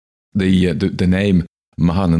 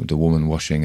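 ordinary talk

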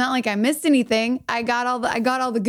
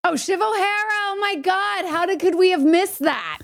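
delighted realization; 8 semitones higher than usual. Real, not acted. Co-host hears the perfect henchwoman name, delighted realization.

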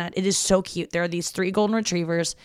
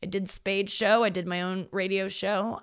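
usual speaking voice

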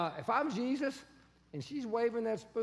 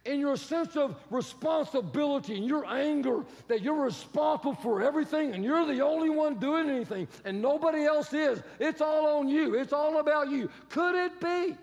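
builds louder toward a pleading peak; rising insistence; 4 semitones higher, 5 dB louder than usual. Acted. Preacher urging Martha, building loud. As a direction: press her harder with each phrase.

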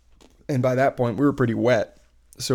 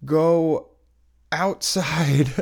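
imitated staff directions; 5 semitones higher, 5 dB louder, much slower than usual. Voicing staff's unwelcome directions.

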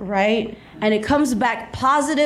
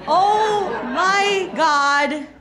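high, slow, drawn-out disbelief; high-pitched, slow-motion panic; mock horror; 6 semitones higher, much slower than usual. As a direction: stretch each word in hammed-up dread. Retold horrified thought at the airport.

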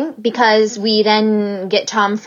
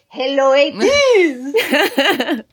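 higher mock Italian accent, laughing; 7 semitones higher, much slower than usual. Quoting [name]'s greeting, mock Italian accent. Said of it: "And her whole, like".

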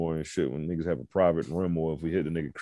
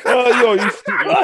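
laughing; 8 dB louder, slower than usual. Real, not acted. Laughing at friend's joke.

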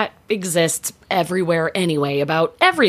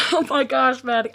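high-pitched horrified gasp of sympathy; higher-pitched, appalled disbelief; real shock; 7 semitones higher than usual. Real, not acted. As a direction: react aghast to a friend's scary story. Listener reacts to stroller accident.

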